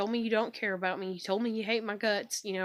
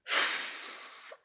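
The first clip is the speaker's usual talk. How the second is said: long breathy sigh; weary sigh. Real, not acted. Fed up with waiting for her to get done, long sigh. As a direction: let out a heavy exhale.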